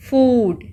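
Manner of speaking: फूड with a long oo. Shown as a demonstration. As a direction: stretch the vowel in फूड. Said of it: "इसमें भी long sound है".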